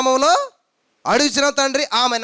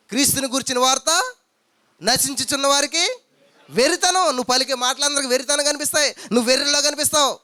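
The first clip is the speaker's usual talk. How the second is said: raised fervent preaching; preaching at full force; 4 semitones higher, 5 dB louder than usual. Acted. Preacher rousing the congregation, fervent and raised. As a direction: drive the point home to the church.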